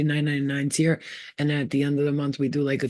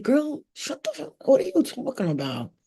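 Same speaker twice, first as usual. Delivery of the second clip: self-scolding; 8 semitones higher than usual. Retold or imitated. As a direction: tell yourself off, sassy. Scolding herself.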